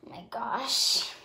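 slow hushed whisper. Real, not acted. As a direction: breathe it out in a whisper. Quiet reaction in the car.

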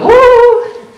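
high, loud whoop; a sudden mock celebration; mock cheer. Preacher mimicking a cheering crowd, loud mock whoop. Flesh doesn't cheer this passage.

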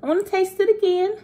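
put-on high, quick kid voice; seven-year-old self. Wanting another taste, pretend seven-year-old voice. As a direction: say it fast and high like a child. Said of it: "transitioning to my seven-year-old self".

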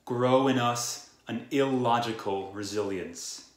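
usual speaking voice

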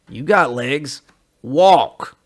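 loud, curt brush-off; curt angry. Acted. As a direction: bark it dismissively. Joe's curt refusal.

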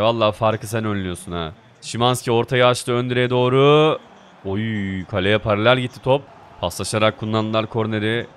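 ordinary talk, nothing marked

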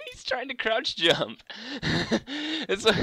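high, giggling through the words; laughing while talking. Real, not acted. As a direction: talk through laughter at a friend. Laughing at friend's fail.

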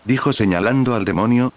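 usual speaking voice

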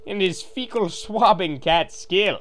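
ordinary talk, nothing marked

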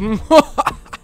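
evil laugh. Acted. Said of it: "It's good to be evil".

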